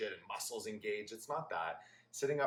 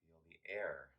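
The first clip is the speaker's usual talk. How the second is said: guided meditation; 3 semitones lower, 8 dB quieter than usual. Real, not acted. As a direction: guide them gently through each breath, hushed and unhurried. Leads a guided breathing meditation.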